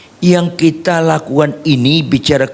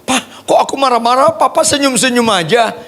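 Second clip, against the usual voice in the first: imitating wife; 10 semitones higher than usual.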